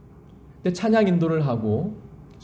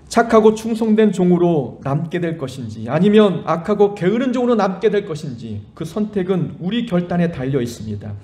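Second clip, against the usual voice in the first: normal talking voice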